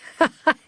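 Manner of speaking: high, loud mocking laugh; sudden laugh. A narrator's reading. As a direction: burst out laughing at the dog. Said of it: "Mister Feezy suddenly started laughing".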